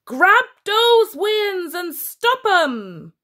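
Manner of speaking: high, loud, slow beast bark; monster command. A narrator's reading. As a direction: order the troops like a brute. General orders his minions.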